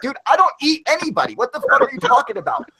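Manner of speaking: high, exasperated protest, pitch climbing; shrill disbelieving dude; indignant imagined reply. Retold or imitated. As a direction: sound baffled and offended at the accusation. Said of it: "It's like".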